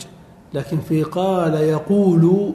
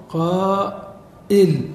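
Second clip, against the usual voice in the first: قائل stretched very slowly, syllable by syllable; slow drawn-out demo; 2 semitones higher, much slower than usual. Shown as a demonstration. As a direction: draw out قائل slowly for the class. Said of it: "فإن العرب تقول". (نطق قائل ببطء شديد وتمديد)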